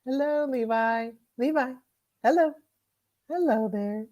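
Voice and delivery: high, sweet voice for a pet. Real, not acted. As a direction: coo at the puppy. Cooing at the dog.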